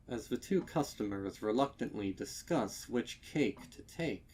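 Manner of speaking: ordinary delivery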